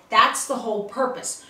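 ordinary talk, nothing marked

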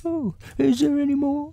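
very high, eager bear voice; squeaky-high storybook bear question; Pooh. A narrator's reading. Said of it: "asked Pooh quickly".

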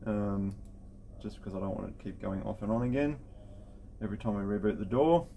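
ordinary talk

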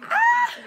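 very high shriek of shock; excited scream. Real, not acted. First snip of her long hair, a very high scream. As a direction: let out a high piercing scream.

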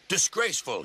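a touch of outraged spluttering. A narrator's reading. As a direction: splutter it with understated crossness. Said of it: "he spluttered".

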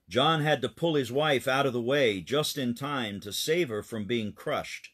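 ordinary delivery

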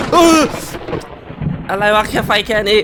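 high, loud scared outburst; startled yell. Real, not acted. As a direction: jolt up and yell in fright. Startled by a sudden game noise. (ตะโกนตกใจเสียงสูงดัง)

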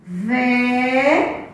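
"Ve" held long with a rising end; syllable by syllable. Shown as a demonstration. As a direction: stretch "Ve" out on its own. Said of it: "Vamos agora separar a palavra".

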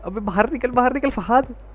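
high, urgent pleading shout; panicked warning. Real, not acted. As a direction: yell at him to get out, voice cracking upward. Doll inside, warns teammate urgently.

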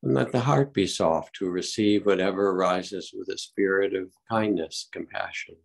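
unhurried calm meditation guide; guided meditation. Acted. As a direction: ease the group gently into stillness.

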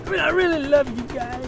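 high, loud fake-weepy plea; mock tearful gushing; mock sobbing. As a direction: ham up the tearful love for fans. Mock sobbing gamer confession.

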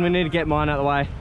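usual speaking voice

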